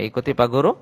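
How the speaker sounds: ordinary delivery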